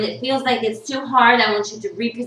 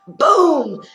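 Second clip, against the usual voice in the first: high, loud burst; booming exclamation; 8 semitones higher, 7 dB louder than usual. Retold or imitated. Imitating the muscular trainer, booming exclamation. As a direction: punch it out big and high. Voicing the trainer's showy arrival.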